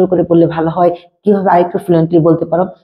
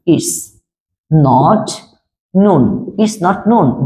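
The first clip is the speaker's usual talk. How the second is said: very slow, word by word for learners; slow model sentence; much slower than usual. Shown as a demonstration. Teacher slowly models English for Bengali students. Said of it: "এখন ইংলিশটা দিব".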